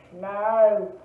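loud, low sheep's refusal; barked. A narrator's reading. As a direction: bark it like a busy sheep. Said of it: "barked the sheep".

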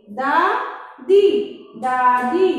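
दादी drawn out slowly, then दादी said crisply; slow then normal. Shown as a demonstration. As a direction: stretch दादी, then say it whole. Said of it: "इसके हम शब्द भी बता देते हैं".

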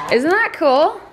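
high, eager question; excited. Real, not acted. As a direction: show it off excitedly. Showing new fish tank.

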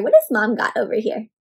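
plain reading voice

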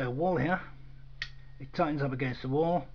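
ordinary talk

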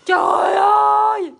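loud, thrilled cry; excited shout. Real, not acted. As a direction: shout it out in delight. Wowed by the lipstick.

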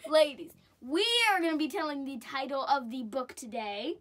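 ordinary delivery

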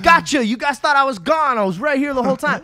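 loud, high playful jump-scare reveal; gotcha reveal. Pretend. Host pops back into the podcast to tease listeners, loud playful reveal.

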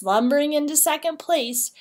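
usual speaking voice

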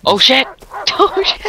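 real startled squeal; panicked shriek. Real, not acted. Panicked in game.

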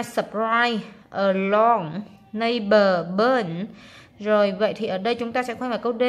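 ordinary delivery

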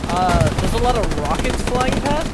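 loud, high alarmed call. Real, not acted. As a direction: shout over rocket fire. Rockets flying past in game.

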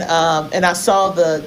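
normal talking voice

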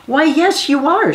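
bright, loud, happy exclamation; amused, pleased mother; delighted mother. Acted. Mother answers child.

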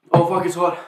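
talking through a burnt tongue; with a sore tongue. Real, not acted. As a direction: speak with a scorched, sore tongue. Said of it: "My tongue hurts".

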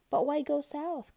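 high, loud lamb protest; high storybook wail of a question; crying out. A narrator's reading. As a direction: cry it out as the puzzled lamb. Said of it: "cried the lamb".

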